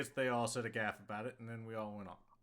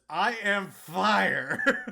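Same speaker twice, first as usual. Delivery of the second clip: dramatic reading; 7 semitones higher, 13 dB louder, much slower than usual. Retold or imitated. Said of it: "All caps".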